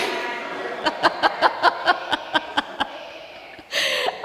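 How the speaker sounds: long, helpless real laughter; laughing fit. Real, not acted. Preacher lost in own sheep joke, helpless laughter. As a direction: keep laughing, unable to go on.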